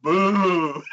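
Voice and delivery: long mock boo; drawn-out booing. Mock heckling while retelling a show.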